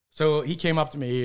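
normal talking voice

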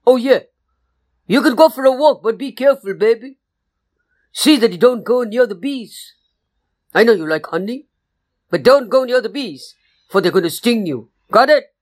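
motherly permission with firm caution; mama bear cautioning. Acted. Mama bear warns baby about the bees, motherly and firm. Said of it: "And Mama said".

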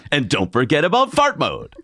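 hyped TV pitchman; infomercial voice. Pretend. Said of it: "if you wanna do an infomercial".

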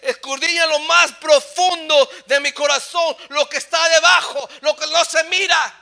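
loud, high declamation; full-force scripture preaching. Real, not acted. Preacher quotes the psalm to the congregation, full-force preaching. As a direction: proclaim the verse at a shout.

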